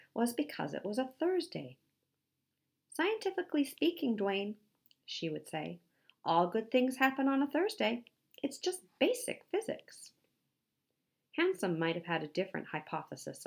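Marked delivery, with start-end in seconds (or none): 2.9-4.6 high-pitched little lecturer; Cece, matter-of-fact know-it-all. Acted. 6.2-10.1 high, self-assured lecturing; pert, high-pitched expert voice; Cece, know-it-all. Acted.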